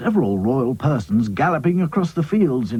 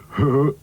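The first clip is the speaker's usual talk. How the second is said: short chuckle in Sad Sack's voice; a quick satisfied chuckle; 3 semitones higher than usual. Acted. Sad Sack laughing at his own boast, short chuckle. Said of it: "he laughed".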